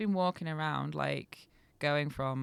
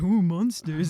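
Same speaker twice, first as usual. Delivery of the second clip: put-on loud, teasing copy of Young; mock spooked imitation; 9 dB louder, slower than usual. Narrator teasing Young to co-host, mock spooked imitation. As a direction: mimic his silly fright, louder. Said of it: "he's gone like".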